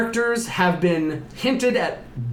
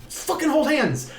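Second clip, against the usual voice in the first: much higher, exasperated retold order; impatient insistence; 11 semitones higher than usual. Mother to reluctant family at the seance, impatient. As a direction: insist in a high mock-impatient voice.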